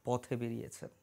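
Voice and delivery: slow, measured, ceremonial narration; solemn story prologue. Acted. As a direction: intone it slowly, with long pauses between phrases. Solemn scripted opening of the story.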